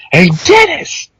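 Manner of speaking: high-pitched heckling yell; retold yell. Dad heckling Dennis across the bowling alley, yelling. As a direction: holler it high across the room. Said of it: "yell at him not to fart".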